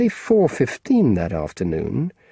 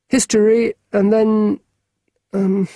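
put-on higher, eager young boy; lightly voiced keen schoolboy; a boy asking a grown-up a favour; boy's voice; 10 semitones higher, 4 dB louder than usual.

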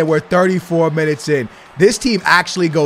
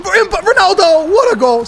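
very high, stumbling rush of names; frantic call; 12 semitones higher, 3 dB louder than usual. Real, not acted. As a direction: lose yourself in the moment.

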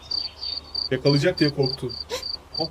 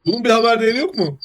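worked up; 8 semitones higher than usual. Real, not acted. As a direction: ride the chaos with rising outrage. Chaos erupts, commentator worked up.

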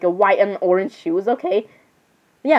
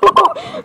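very high, short giggle; laugh. Real, not acted. Vlogger telling the drawing story, high giggle. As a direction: burst of high-pitched laughter.

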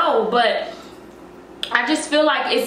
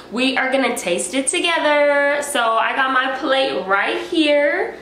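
normal talking voice

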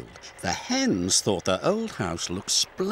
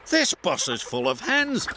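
complaining; 7 semitones higher than usual. A narrator's reading. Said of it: "they complained".

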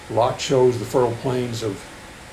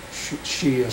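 usual speaking voice

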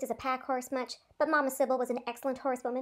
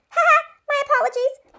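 high, loud nervous laugh through the apology; nervous chuckle; 14 semitones higher, 11 dB louder than usual. A narrator's reading. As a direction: giggle it out embarrassed. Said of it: "Leno chuckled nervously".

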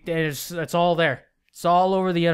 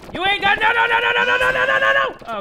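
high, loud frantic denial; panicked no; 14 semitones higher, 4 dB louder, faster than usual. Real, not acted. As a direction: shout no in panic.

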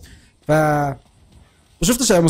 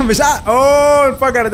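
high loud drawn-out whoa; slow rising gamer whoa; excited whoa; 6 semitones higher, 5 dB louder, slower than usual. Real, not acted. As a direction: stretch the oh out in glee. Watching the plane blow up.

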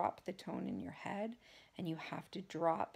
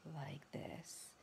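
much softer, low breathy hush; slow deep whisper; 3 semitones lower, 8 dB quieter than usual. Shown as a demonstration. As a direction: drop into a slow calming whisper. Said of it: "a normal person doesn't talk".

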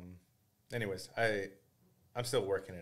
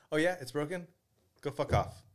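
mock rude clerk; 7 semitones higher, 5 dB louder than usual. Rude clerk brushing off complaint.

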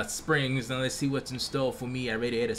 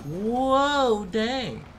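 surprised; 7 semitones higher, 4 dB louder than usual. Real, not acted. Reacting to a jump.

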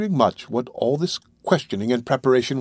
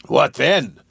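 louder, gruff thrown-back question; angry retort; 3 semitones higher, 5 dB louder than usual. A narrator's reading. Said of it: "replied Sykes with a tremendous oath".

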